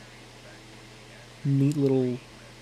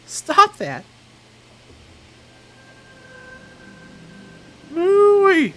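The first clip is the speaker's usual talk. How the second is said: character yelling; 12 dB louder, much slower than usual. Acted. Character yells in game scene.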